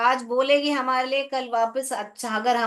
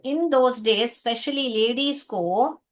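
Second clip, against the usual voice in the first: slow reading; much slower than usual. Shown as a demonstration. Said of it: "teacher reads text slowly".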